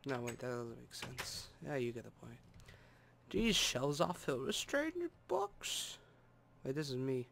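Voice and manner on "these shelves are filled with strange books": slow, higher mock German accent; lightly hammed German accent. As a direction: read the sign in a put-on German accent. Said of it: "So yes, I can be German".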